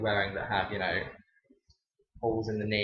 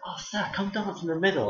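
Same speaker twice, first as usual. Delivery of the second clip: big lads inviting; 9 semitones higher than usual. Retold or imitated.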